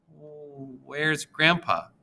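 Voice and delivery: slow, anxious question; breathing fast. A narrator's reading. Girl asking on Skype where grandpa is, anxious. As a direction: dread creeping in as she asks. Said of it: "I ask, breathing fast".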